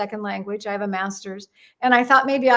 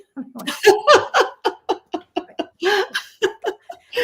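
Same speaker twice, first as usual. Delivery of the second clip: long, high burst of laughter; real helpless laugh; loud laughter; 9 semitones higher, 4 dB louder than usual. Real, not acted. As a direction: crack up at your own punchline. Laughing at her own punchline.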